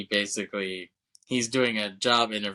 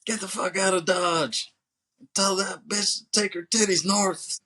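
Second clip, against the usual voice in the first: much higher put-on thug voice, spitting the threat; menacing thug voice; 10 semitones higher, 3 dB louder than usual. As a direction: sneer it like a street thug warning him off. Thug threatens beaten hero.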